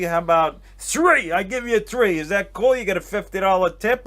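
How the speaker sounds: hammed-up New York owner dealing out cash; mock generous owner. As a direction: imitate a tipsy big spender making an offer. Acting out wasted owner's generous offer.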